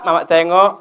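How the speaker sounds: usual speaking voice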